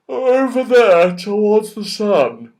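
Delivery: loud, slow bear voice; a big bear calling out, slow and loud; father bear. A narrator's reading. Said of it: "said the father bear".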